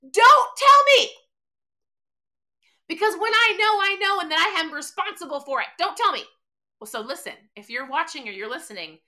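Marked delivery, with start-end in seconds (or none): 0.0-6.2 high, loud mock outburst, easing as it goes; Tony. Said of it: "our friend Tony sometimes goes like this".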